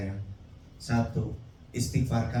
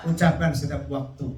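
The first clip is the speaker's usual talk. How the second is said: normal talking voice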